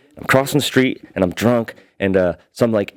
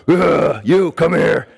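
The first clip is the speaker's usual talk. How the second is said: growled, raised-pitch challenge; imitated angry man; 6 semitones higher, much slower than usual. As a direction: play the furious man coming at you. Retold angry man confronts him.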